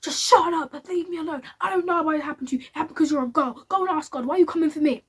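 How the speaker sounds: imitated angry outburst, high and loud; fed-up mum. Mum turns round to her complaining daughter, angry shouting.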